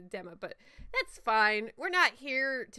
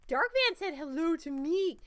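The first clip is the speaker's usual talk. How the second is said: puppet voice; 7 semitones higher, 4 dB louder than usual. Acted. Puppet voice continues.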